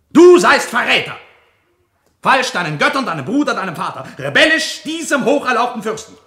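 loud, high denouncing shout; furious accusation. Acted. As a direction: hurl the charge of treason.

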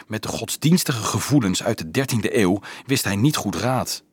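plain reading voice

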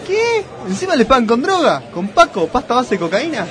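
high, outraged disbelief; shocked. Acted. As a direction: gasp it out in shock. Jugador escandalizado. (sorpresa escandalizada, aguda)

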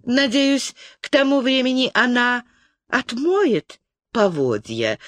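ordinary delivery, nothing marked

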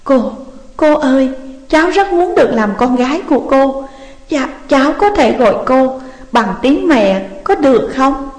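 tearful young woman's plea, voiced by a narrator; choked up. A narrator's reading. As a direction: plead as if holding back tears. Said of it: "nghẹn ngào nói".

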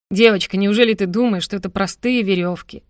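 plain reading voice

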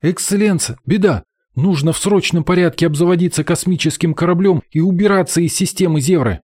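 fast, high and urgent; gabbling in alarm. Acted. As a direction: blurt it out in a panic. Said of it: "затараторил".

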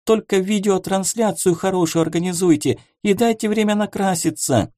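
high, flustered princess protest; pampered princess voice. Acted. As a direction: play a spoiled princess, high and fussy.

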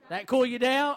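loud mock taunt. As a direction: throw the line back mockingly. Repeating the clip's taunt.

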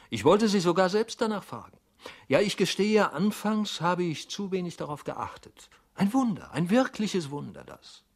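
high-pitched, emphatic wonder; excited astonishment, voice far up; amazed. A narrator's reading. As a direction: marvel openly at a miracle. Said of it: "riss die Augen immer weiter auf".

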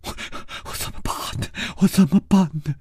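put-on girl sobbing face-down on the bed; choked sobbing. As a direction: choke on the words, near tears. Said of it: "她哽咽着说".

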